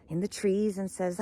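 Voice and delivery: ordinary delivery, nothing marked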